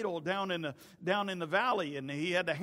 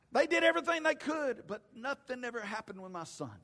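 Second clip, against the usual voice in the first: despairing account; 10 semitones higher, 6 dB louder than usual. Retold or imitated. Father telling Jesus the disciples failed, impassioned then deflated. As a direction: start impassioned, then let the hope drain away. Father recounts failure, sinking.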